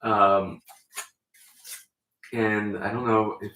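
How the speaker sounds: usual speaking voice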